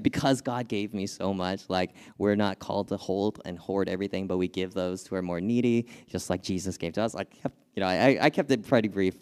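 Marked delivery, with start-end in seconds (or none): none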